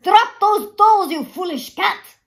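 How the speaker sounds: put-on old shopkeeper yelling at a naughty cat; shouting. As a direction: bellow at the cat to drop them. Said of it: "shouted Boxer".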